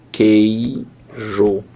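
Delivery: QUEIJO drawn out in two beats, KEI... JO; sounded-out syllables. Shown as a demonstration. Teacher sounding out queijo to show the sound, slow split syllables. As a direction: say QUEIJO slowly, split syllable by syllable, hard K start. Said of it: "ouvindo isso aqui".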